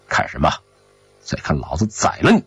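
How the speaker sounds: low, quick, restrained menace; menacing threat. A narrator's reading. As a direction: threaten him under your breath. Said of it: "霍振西凶相毕露". (压低声音，凶狠威胁)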